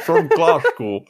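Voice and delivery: a hammed-up Glasgow accent broken by giggles; laughing Glasgow attempt. Friend dares him to try, a laughing mock Glasgow accent. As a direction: try a wee Glasgow voice and crack up. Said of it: "See, give it a go".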